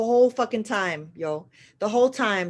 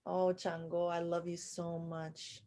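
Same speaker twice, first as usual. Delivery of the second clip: very soft, slow and loving; tender devotion; 14 dB quieter than usual. Real, not acted. Telling Chango she loves him, very softly. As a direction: speak to him like a beloved.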